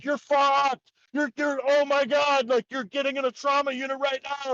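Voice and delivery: high-pitched mock panic; alarmed. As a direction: act out astonished doctors in a high, urgent voice. Doctors react in alarm to the scan.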